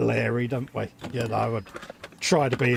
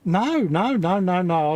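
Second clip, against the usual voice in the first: mock protest; 6 semitones higher, 5 dB louder, slower than usual. Playing along with the sniffles bit.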